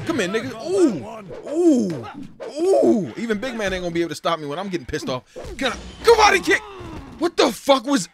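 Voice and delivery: loud, high hype shouting; excited shout. Real, not acted. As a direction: yell it pumped up mid-fight. Excited fighting in game.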